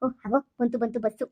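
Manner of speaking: usual speaking voice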